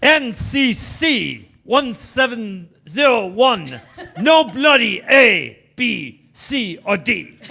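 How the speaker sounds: slow, deliberate mock-Scottish engineer; dramatic Scottish accent, drawn out; Scotty. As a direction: spell it out slowly in a hammed-up Scottish burr. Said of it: "that's Scotty from Star Trek".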